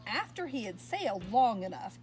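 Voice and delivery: usual speaking voice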